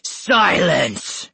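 fierce monster's order; roaring beast. A narrator's reading. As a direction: roar the command like a beast. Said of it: "the Beast roared".

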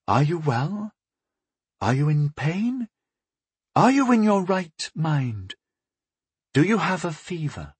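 slow, careful questions; cautious. A narrator's reading. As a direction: ask gently, feeling her way. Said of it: "she asked me, slowly, cautiously".

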